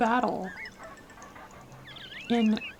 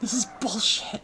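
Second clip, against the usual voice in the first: whispering. Real, not acted. Frustrated whisper.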